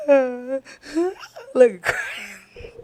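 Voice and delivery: high, hammed-up bawling; mock crying. Telling how she cried in jail, mock sobbing. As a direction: imitate ugly crying through the words. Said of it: "I'm sitting there crying".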